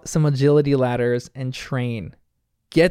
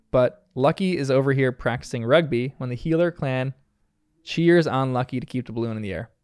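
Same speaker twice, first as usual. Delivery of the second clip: ordinary talk, nothing marked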